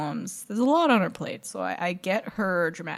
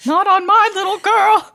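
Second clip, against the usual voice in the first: high, loud, shocked gasp; alarmed mother; 11 semitones higher, 8 dB louder than usual. Pretend. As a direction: clutch pearls like a scandalized mom. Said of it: "scare the mother, so, like".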